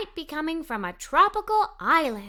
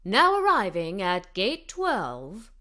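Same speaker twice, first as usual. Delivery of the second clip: slow, lower announcer over a loudspeaker; loudspeaker announcement; 6 semitones lower, slower than usual. A narrator's reading. Airport loudspeaker calls the arriving flight, slow announcer voice. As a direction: read it out like an airport tannoy. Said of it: "someone on a loudspeaker said".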